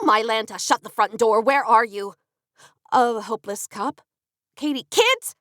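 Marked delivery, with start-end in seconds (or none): 4.5-5.3 put-on a high call to the kids. A narrator's reading. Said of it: "Katie screamed".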